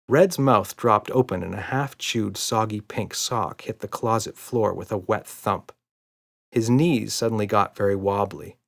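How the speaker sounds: ordinary delivery, nothing marked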